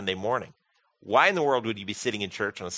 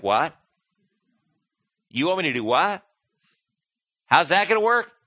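incredulous; 5 semitones higher, 6 dB louder than usual. Retold or imitated. Incredulous answer to God.

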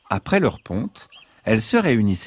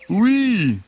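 high coaxing fox; the fox, all innocence; 12 semitones higher, 4 dB louder than usual. Acted. Fox answers the hens.

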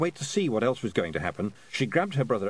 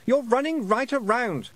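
put-on shouting boy; 12 semitones higher, 5 dB louder than usual. A narrator's reading. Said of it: "louder now because he was catching".

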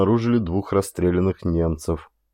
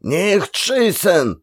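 much higher, drawn-out German cry; yelled plea; 10 semitones higher, much slower than usual. A narrator's reading. German soldier begs not to be shot, yelling. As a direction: scream it with hands raised. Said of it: "заорал второй немец".

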